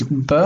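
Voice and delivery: b as a hard stop, lips closed then released; hard bet sound. Shown as a demonstration. Teacher showing bet with dagesh, a hard b sound. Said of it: "Remember, bet with dagesh".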